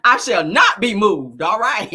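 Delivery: loud, drawn-out preacherly vow; mock-defiant declaration. Vowing to the viewers to stand firm, mock-defiant. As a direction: declare it slowly with full force.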